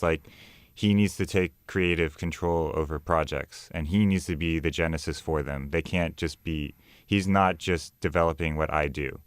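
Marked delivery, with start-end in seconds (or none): none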